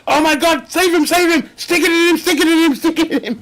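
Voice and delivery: mock panicked yelling. Mock medical emergency scene.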